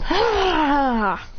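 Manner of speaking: high, drawn-out "ah"; long falling cry; acted cry. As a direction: let out a high mock battle cry. Acts out character's cry.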